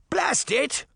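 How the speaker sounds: put-on high, exasperated shout in a thick English accent. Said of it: "the kid shouted in a thick English accent".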